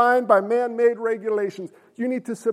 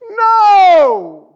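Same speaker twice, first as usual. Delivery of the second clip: loud, high, emphatic NO; a preacher's thunderous no; shouted no; 7 semitones higher, 11 dB louder than usual. Real, not acted. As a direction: shout the answer down hard.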